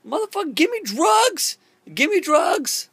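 high-pitched frantic mock plea; panicked demand. Patient retelling his demand to the doctor for drugs, high frantic voice.

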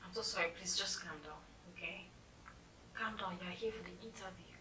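gentle placating. Acted. Secretary calming an impatient interview applicant, gently placating. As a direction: quietly settle him down.

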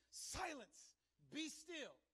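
high, commanding order, each part set apart; stern rebuke. Acted. Jesus rebuking the wind and waves, stern command. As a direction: command it with full authority. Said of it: "he rebuked".

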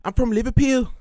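high, loud mock Liverpool accent; Scouse accent. Asked for his best Scouse, he hams up a Liverpool accent. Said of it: "do your best Liverpudlian accent".

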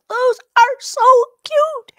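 whispered squeal, high and slow, almost no voice; breathless gush. Retold or imitated. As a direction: swoon at tiny cowboy boots in a hushed, airy squeal. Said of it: "And you're like".

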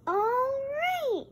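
high cheerful toy voice. Pretend. New toy character speaks.

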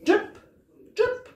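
high plinking drip sounds; dripping tap. Acted. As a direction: pluck each drip high like a leaky tap. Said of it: "went the leaky kitchen tap".

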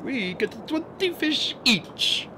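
a slow, high eel bragging about its ration; greedy eel voice. A narrator's reading. Eel Fortuano claims twenty fish, slow and high. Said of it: "said another eel".

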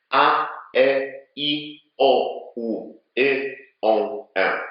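vowels with big, exaggerated lip movement; exaggerated vowel drill. Shown as a demonstration. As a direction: stretch the lips wide on every vowel. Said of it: "każda z tych samogłosek ma swój charakterystyczny kształt". (przesadny ruch warg przy samogłoskach)

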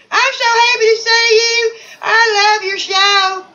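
very high, drawn-out fan voice; gushing contestant. Acted. Edwina telling host she loves the show, high gushing. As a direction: gush in a high put-on voice.

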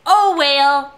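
loud "well" drawn out as "whale"; whale pronunciation. Acted. Capping the scab story, "well" loudly turned into "whale". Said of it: "But with a whale".

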